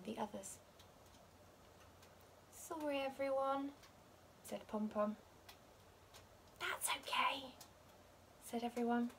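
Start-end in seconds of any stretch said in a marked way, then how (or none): 2.5-3.7 softer, humble apology; sheepish sorry. A narrator's reading. Pom Pom apologising to his friends, soft sorry. 6.6-7.5 whispered reassurance; gentle forgiving whisper. A narrator's reading. Friends forgiving Pom Pom, soft whisper.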